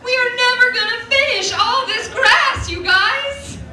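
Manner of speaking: high, loud, giddy cow voice; happy cow voice. Retold or imitated. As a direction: squeal with joy about all the grass. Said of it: "They're just out in the field like".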